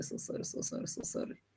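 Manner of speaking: soft whispered apology. Real, not acted. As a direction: murmur sorry under your breath. Apologizing under breath.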